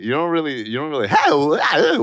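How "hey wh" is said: mocking imitation. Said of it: "You only do that in comedy".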